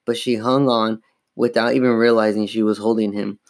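ordinary delivery

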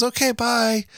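put-on high-pitched little-kid goodbye; Mikey. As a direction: imitate a small boy signing off. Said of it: "He goes".

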